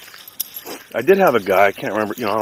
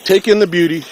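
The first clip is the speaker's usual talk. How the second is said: calling out; 10 semitones higher, 9 dB louder than usual. Real, not acted. Calling out at the scenery.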